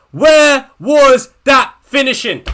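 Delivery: each word shouted out slowly; loud, slow, exasperated yell; slow furious shout. Real, not acted. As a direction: bellow the question in real anger. Repeats the question, shouting slower.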